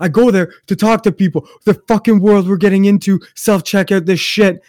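imitated ranting, loud and high; angry tirade. Friend railing against self-checkout in the mall, imitated tirade. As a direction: act out a friend freaking out. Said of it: "he just goes on this tirade".

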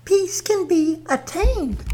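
high, slow airy goddess voice; a serene earth goddess speaking slowly; Mother Nature voice. Acted. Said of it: "Right on, Mother Nature".

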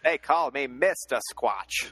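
a light Sidney Poitier voice; Sidney Poitier impression.

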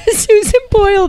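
repeating it through laughter; laughing. Real, not acted. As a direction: giggle while you say it. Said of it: "That's what Gail just said".

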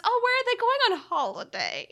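British holiday. Retold or imitated. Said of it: "trying to mirror language".

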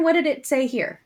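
ordinary talk, nothing marked

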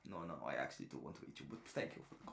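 low, soft, hurried murmur; mock polite cannibal. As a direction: play a polite cannibal, hushed and fading to a whisper. Acting the cannibal politely declining.

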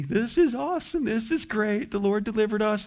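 high, gleeful cheering voice; giddy high celebration; mock elated crowd. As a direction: cheer like freed people. Voicing happy Israelites.

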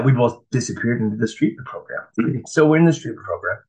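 normal talking voice

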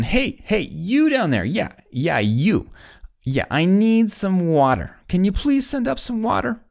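high-pitched calling down from a height; mock yell down. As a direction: holler to a worker far below, playfully acted. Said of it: "yells down again".

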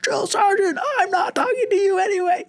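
high frantic recruit yell, half-laughing. Retold or imitated. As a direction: shout back like you've snapped. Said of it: "He's like".

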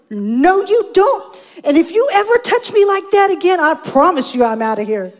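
loud, high, furious threat; angry warning. Retold or imitated. Wife jumping out of bed warning her husband, shouting. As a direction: lay down the law, heated.

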